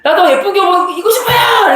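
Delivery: high, loud shout with a laugh; half-laughing yell at father. Retold or imitated. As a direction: blurt it out like a frustrated teen. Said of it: "소리를 이렇게 지를 뻔하다가".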